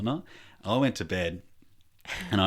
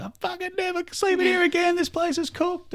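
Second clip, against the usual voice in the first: very high, louder mock-hysterical outburst; panicked rant; 5 dB louder than usual. Dad rings Mum about the house, high hammed-up panic. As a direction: squeal it in comic panic.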